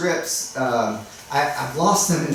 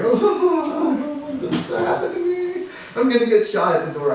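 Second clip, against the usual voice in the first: much higher, hammed-up fearful squeal; mock panic; 12 semitones higher than usual. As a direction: play your old nervous self. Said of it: "I was like".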